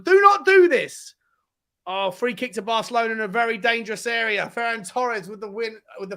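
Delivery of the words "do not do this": loud, high, drawn-out plea; urgent plea. Real, not acted. Fan pleading with Tottenham, urgent plea. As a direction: stretch each word out, high and loud. Pleading with his team live.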